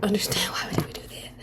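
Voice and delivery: whispered aside; hushed disbelieving remark. Real, not acted. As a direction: whisper it to the viewers.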